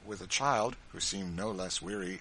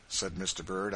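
ordinary delivery

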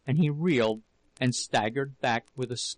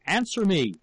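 higher, raised-voice command; angry shout; 6 semitones higher, 4 dB louder than usual. A narrator's reading. Husband pounding the table at his wife, angry shout. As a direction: shout the demand at her. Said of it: "shouted again at her".

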